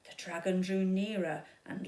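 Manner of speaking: usual speaking voice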